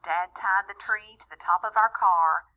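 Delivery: ordinary delivery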